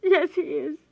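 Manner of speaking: crying. Acted. Daughter confirming her father is dead, tearful. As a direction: push the words through tears.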